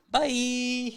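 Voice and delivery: high, drawn-out bye; mock-sweet "byeee"; sing-song goodbye. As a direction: chirp a long, high-pitched bye. Retold taunting goodbye while backing away.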